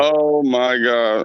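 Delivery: low, slow groan of dread; real dismay. Real, not acted. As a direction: sink into it, drawn out and despairing. Fan dreads losing the series.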